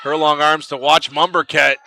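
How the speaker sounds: brisk live basketball call, rising on big plays. Acted. Commentator calling the game to fans, brisk play-by-play.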